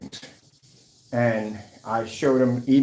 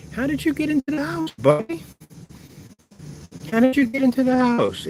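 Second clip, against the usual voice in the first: high doting voice for the little bird; pet-talk voice; 13 semitones higher than usual. Real, not acted.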